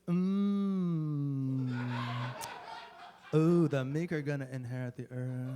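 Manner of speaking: drawn-out, odd crooning delivery of scripture; weird preacher voice. Acted. Comic voicing Jesus to explain the crucifixion, weird voice. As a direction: put on a strange, slow holy-man voice. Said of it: "he must have had a weird voice".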